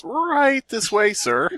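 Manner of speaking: high, slow polite receptionist voice; mock desk worker. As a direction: usher him in like a fake-sweet clerk. Mimics front desk worker.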